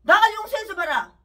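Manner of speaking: preacher shouts his anger at the pastor; furious shout. Real, not acted.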